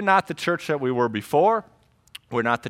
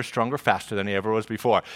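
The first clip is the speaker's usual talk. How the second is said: quick, softer rush; eager anticipation; 5 semitones lower, 4 dB quieter, faster than usual. Retold or imitated. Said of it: "I'm like".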